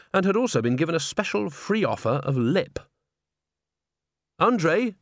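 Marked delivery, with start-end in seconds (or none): none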